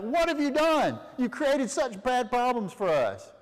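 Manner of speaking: high-pitched, hammed-up dismay; acted high, distraught scolding; aghast father scolding. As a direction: wail the reproach at your sons in mock horror. Jacob confronts sons after the massacre.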